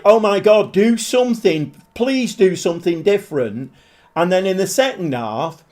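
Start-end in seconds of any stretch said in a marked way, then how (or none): 0.0-3.7 begging the manager, pitched up and loud; fed-up fan crying out; exasperated plea. Retold or imitated. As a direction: plead with the team to change something. Fan despairing at boring play.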